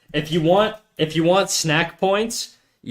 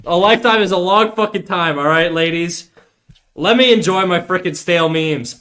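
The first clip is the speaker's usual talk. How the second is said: higher, heated comeback; triggered rant; 5 semitones higher, 4 dB louder than usual. Retold or imitated. Streamer telling off ladies over his stale memes, triggered rant. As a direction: rant back defensively, pitched up and punchy. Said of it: "I just got triggered".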